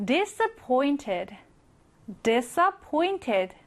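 disappointed with the stress on pointed. Shown as a demonstration. Teacher stressing the pointed part of disappointed. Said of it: "이 pointed, 이 부분에 강세가 들어갑니다".

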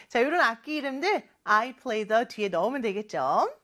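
ordinary talk, nothing marked

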